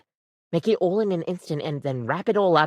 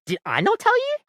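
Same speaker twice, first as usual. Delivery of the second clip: shocked disbelief. Acted. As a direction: pitch shoots way up in sudden surprise. Shocked the customer hadn't heard.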